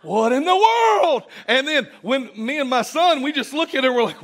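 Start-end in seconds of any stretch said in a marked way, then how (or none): 0.0-1.2 high, slow, loud amazed cry; what in the world, stretched and high; astonished. Retold or imitated. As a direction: gasp it out in disbelief. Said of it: "And I'm like".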